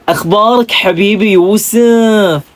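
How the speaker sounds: slow, sweet Hasawi lilt with Yusuf drawn out; smooth Hasawi accent. Shown as a demonstration. Vlogger showing viewers the Hasawi accent, smooth and slow. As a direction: draw it out like a sweet-talking Hasawi. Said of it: "napaka-smooth at napakabagal magsalita".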